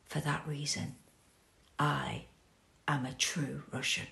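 soft, low whispered vow; whispered proud ending; hushed declaration. Acted. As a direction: close on a quiet whisper. Quiet final declaration.

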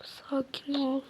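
soft shaky whisper; whispered calming. Acted. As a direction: whisper to calm yourself. Fısıltıyla sakinleşme. (fısıltıyla sakinleştirme)

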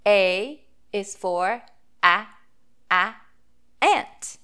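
short a sound drawn out, a, a, ant. Shown as a demonstration. Teacher sounding out "ant" for the reading circle, slow and clear. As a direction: model the short a in "ant" for young readers. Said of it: "the sounds that the vowel letter A makes".

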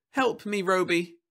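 put-on high child's plea; a small girl asking for help; little girl voice.

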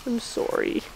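soft, breathless whisper of sorry; whispered apology. Real, not acted. Whispering sorry to the grieving mother ewe, softly. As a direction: barely whisper it, tearful and tender.

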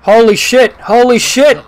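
loud, high shocked cry; shocked exclamation. Real, not acted. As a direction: blurt it out in disbelief. Reacting to bus crash.

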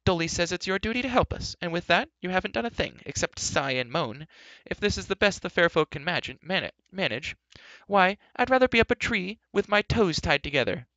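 high, loud, scolding rant; exasperated, complaining tirade; indignant outcry. A narrator's reading. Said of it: "cried Elmy".

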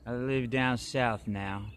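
drawn out, very slow; slow southern pace. Shown as a demonstration. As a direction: stretch each word out lazily. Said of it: "I'm not allowed to talk fast anymore".

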